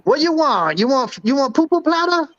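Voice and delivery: high mock Chinese accent; Mister Wang voice. [name] playing Mister Wang to the hosts, high mock Chinese accent. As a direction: raise the pitch into a broad Chinese accent. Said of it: "Mister Wang".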